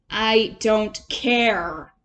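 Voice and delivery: very slow, evasive brush-off; dodging. A narrator's reading. Rat evading Toad's invitation, slow and dodgy. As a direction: drag it out to avoid the question. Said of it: "said the rat dodgingly".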